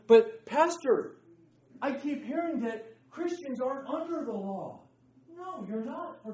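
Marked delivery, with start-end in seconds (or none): 0.1-4.8 mock student objection. Teacher acting a doubting student asking the pastor, mock plea. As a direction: play the puzzled questioner.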